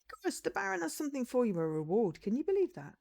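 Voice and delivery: high-pitched villager sharing news; excited villager. Acted. Villager gives Icarus exciting news.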